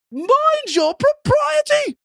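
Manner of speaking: hammed-up Victorian propriety; prim Victorian gentleman. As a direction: scold like a stuffy Victorian host. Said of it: "so Victorian".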